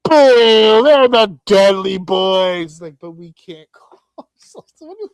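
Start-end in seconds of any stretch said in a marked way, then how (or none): 0.1-2.8 loud, slow jeering boo; hammed-up fan jeer; mock booing fans. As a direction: boo it like a sore crowd. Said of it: "It's like".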